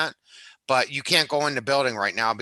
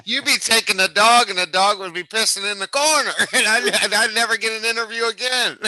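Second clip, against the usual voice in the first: laughing; 7 semitones higher, 4 dB louder than usual. Real, not acted. Laughing at the dog mishap.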